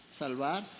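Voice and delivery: normal talking voice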